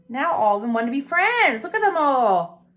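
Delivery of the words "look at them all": ordinary delivery, nothing marked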